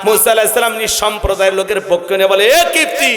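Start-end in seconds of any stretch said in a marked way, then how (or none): 2.6-3.1 calling out. Retold or imitated. Musa calls out to the Copt in a raised voice. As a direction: hail him sharply.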